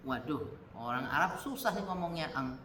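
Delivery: ordinary talk, nothing marked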